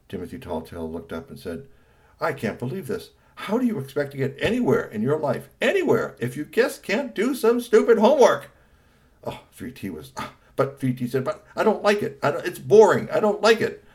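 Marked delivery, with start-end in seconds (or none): 2.2-8.5 scolding that climbs high and loud; exasperated father. Acted. Father scolds son over homework, exasperated. 11.2-13.8 put-on high, loud childish protest; whining boy. Son complains to father homework is boring, whining.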